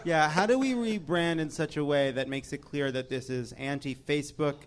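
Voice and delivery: normal talking voice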